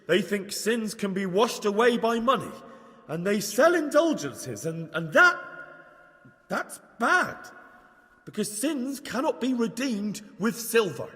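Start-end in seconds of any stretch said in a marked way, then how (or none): 4.7-7.4 faltering, then pitched up; nervous amateur sermon, losing the thread; unsure preacher. Acted. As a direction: stumble for words, then blurt it out. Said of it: "didn't do much for his confidence".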